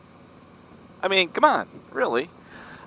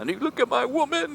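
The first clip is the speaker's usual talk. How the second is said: mock-drunk accusation, pitched high; lightly hammed-up belligerent drunk; drunk heckler; 14 semitones higher, 4 dB quieter than usual. Drunk concertgoer accusing the big guy, belligerent mock whine.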